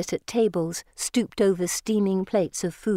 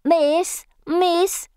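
put-on very high, loud begging child; high, insistent child's call; children crying out; 11 semitones higher, 5 dB louder than usual. A narrator's reading. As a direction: call out for attention like street urchins. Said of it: "they cry".

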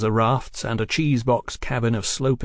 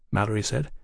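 ordinary delivery, nothing marked

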